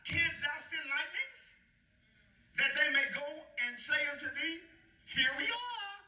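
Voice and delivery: grand declaiming, last words sky-high; dramatic scripture reading. Acted. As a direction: read as God taunting Job, rising high. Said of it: "that's bad the way God worded that".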